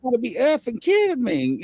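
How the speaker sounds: high-pitched retold disbelief; reliving a freak-out, pitch jumping up; retold flipping out. Storyteller relives spotting Sasquatch to friends, high-pitched disbelief. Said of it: "I was flipping out".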